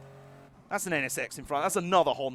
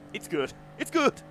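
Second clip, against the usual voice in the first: hint of a referee's call, second one higher; lightly voiced football official announcing; NFL referee; 5 semitones higher than usual. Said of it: "in the voice of a, uh, N F L referee".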